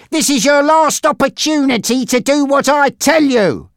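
high-pitched ultimatum; threatening gangster Frankie. Acted.

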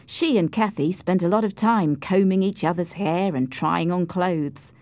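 ordinary delivery

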